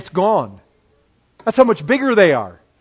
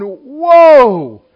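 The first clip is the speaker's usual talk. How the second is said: loud, drawn-out whoa of wonder; a viewer gasping at a nature show; amazed whoa; 4 semitones higher, 11 dB louder than usual. Retold or imitated. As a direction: let it burst out in awe. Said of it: "you're going".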